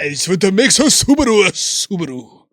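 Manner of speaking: anime-style car ad line. Pretend. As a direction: squeal it like an anime character. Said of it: "You sound like an anime".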